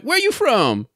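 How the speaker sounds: a goofy disguised voice to fool the caller; stupid voice. Retold or imitated. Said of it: "I put on some stupid voice".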